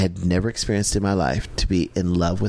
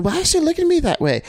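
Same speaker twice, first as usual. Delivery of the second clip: inner puzzlement. Retold or imitated. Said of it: "in my mind, I was going".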